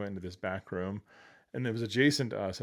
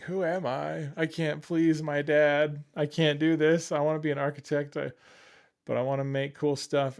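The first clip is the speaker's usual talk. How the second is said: higher-pitched, frustrated self-pity; disappointed retelling; 6 semitones higher, 5 dB louder than usual. Retold or imitated. Son let down by his dad, disappointed lament. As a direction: act out a let-down younger self, voice raised. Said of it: "being so disappointed".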